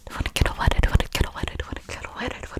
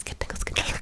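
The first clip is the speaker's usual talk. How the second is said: normal talking voice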